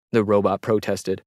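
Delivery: usual speaking voice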